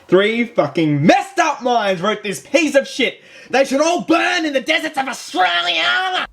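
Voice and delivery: furious rant rising to a high-pitched shout; comic outburst climbing in pitch; angry rant. Acted. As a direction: start outraged and build to a high yell. Ranting at the film's writers.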